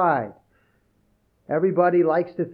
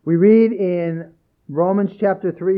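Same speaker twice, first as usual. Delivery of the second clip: ordinary talk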